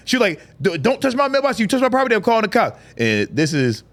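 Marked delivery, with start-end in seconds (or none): 0.6-2.8 high, stammered indignant warning; high-pitched retold threat; mock warning. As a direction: imitate an old lady scolding as she backs off. Acted neighbour warns while backing up.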